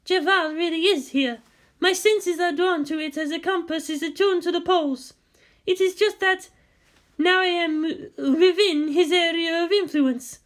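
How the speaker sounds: high, airy mystic woman's voice; a mysterious guide explaining, voiced high; Hassia's voice. A narrator's reading. Guide explains the hidden land, high mystic voice.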